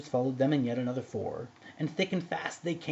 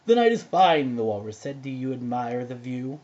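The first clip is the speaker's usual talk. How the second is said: sly walrus; 8 dB louder than usual. A narrator's reading.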